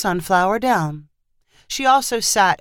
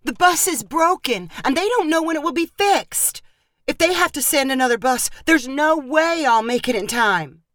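high, panicked pleading, higher and rushing; a daughter in hysterics over a broken bus; hysterical; 8 semitones higher than usual. Acted. Calling her mother, panicking about missing the interview. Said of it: "in complete hysterics".

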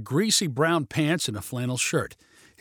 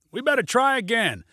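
high raised call; shouted suggestion; 8 semitones higher than usual. A narrator's reading. Pilot proposes another landing, high shout. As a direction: call it out pitched up. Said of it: "shouted Junie".

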